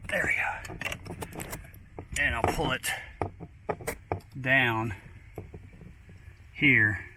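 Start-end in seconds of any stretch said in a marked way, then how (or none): 0.1-1.4 whispered. Real, not acted. Quiet relief as part comes.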